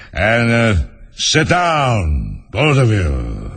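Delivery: slow, lazily commanding lion; lightly voiced, lumbering lion; sleepy lion. A narrator's reading. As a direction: order them about drowsily. Sleepy lion gives orders.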